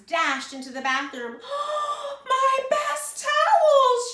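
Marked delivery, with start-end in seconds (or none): 1.4-2.2 loud, high theatrical gasp; horrified gasp. A narrator's reading. Missus Rogers discovers ruined towels. 2.2-4.1 slow, very high shriek; horrified wail. A narrator's reading. Missus Rogers discovers ruined towels.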